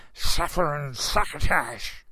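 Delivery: slow, lisping mock-angry "Suffer and succotash"; a hint of Daffy Duck, slow and lisped; Daffy Duck impression. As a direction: sputter it like an angry cartoon duck. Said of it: "when he got angry saying".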